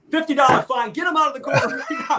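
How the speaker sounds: judge's angry outburst. Retold or imitated. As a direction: bark out the ruling like an outraged judge. Angry judge retold, ordering removal.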